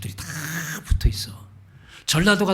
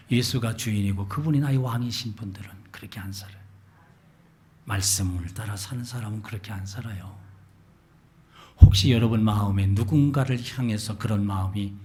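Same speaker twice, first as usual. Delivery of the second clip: normal talking voice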